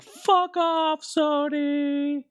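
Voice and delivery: high, drawn-out announcer jeer at Sony; high, stretched mock-announcer taunt; big announcer taunt. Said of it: "I wanna put, like, reverb on my voice".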